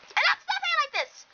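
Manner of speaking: high, fast exclamation; sudden high-pitched cry; abrupt outburst. Acted. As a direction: blurt it out high and quick. Abrupt protest to mother.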